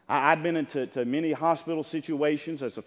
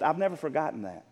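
ordinary talk

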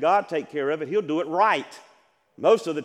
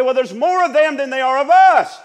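a churchgoer's complaint voiced much higher and louder; worried objector; 8 semitones higher, 13 dB louder than usual. Preacher voicing a doubter in the pews, loud and high. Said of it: "You say".

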